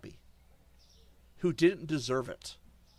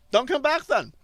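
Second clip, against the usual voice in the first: loud, sharp, higher dismissal; angry shout; 8 semitones higher, 7 dB louder than usual. Real, not acted. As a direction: throw it at them hard. Tells complaining viewers off.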